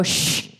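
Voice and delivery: sharp "shh" to hush someone; long hushing hiss; retold shush. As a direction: imitate Dad's urgent shush. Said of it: "I hear my dad go".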